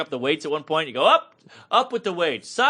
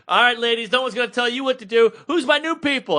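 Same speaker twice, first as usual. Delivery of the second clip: spin instructor; 7 semitones higher than usual. Retold or imitated. Spin teacher shouting moves at the class. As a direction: pump up the class like a spin coach. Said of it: "she's like".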